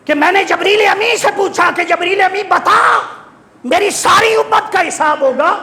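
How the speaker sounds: high, ringing declaimed question; fervent sermon voice, pitched very high; impassioned preaching. Acted. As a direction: put the question with full pulpit fervour. Preaches quoted question at full force.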